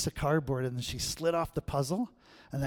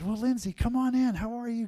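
lightly voiced grandma, higher and sing-song; grandma greeting; 8 semitones higher than usual. Grandma welcoming granddaughter at the door, lightly voiced higher. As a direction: welcome the child in, pitched up. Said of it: "And she said".

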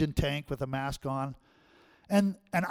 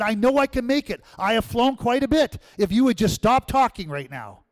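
high, loud, insistent; loud, high, insisting; heated arguing; 6 semitones higher, 6 dB louder than usual. Retold or imitated. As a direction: push back hard at the agent. Arguing at airline counter.

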